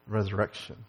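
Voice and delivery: ordinary talk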